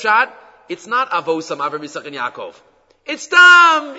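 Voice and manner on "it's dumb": loud, high burst of exasperation; exasperated outburst. Real, not acted. Teacher decrying empty habit to students, exasperated outburst. Impassioned outburst at rote practice.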